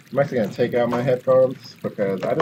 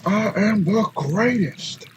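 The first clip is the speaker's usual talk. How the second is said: slow, higher boxer's boast; Muhammad Ali; 10 semitones higher, 3 dB louder, slower than usual. Retold or imitated. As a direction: proclaim it slowly like a champion boxer. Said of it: "Muhammad Ali is one of my".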